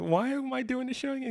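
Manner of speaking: very high, baffled retold question; exasperated-sounding mock query; incredulous protest. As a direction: push the pitch way up in mock disbelief. Retelling his objection to label.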